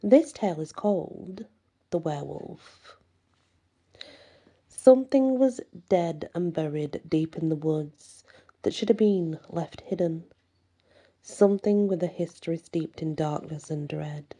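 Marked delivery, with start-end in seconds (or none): none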